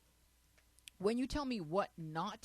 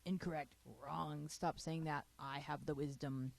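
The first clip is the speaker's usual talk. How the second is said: mock authority; 6 semitones lower, 7 dB quieter than usual. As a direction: lay down the law in a lowered mock-solemn voice. Voices panelist correcting her with authority.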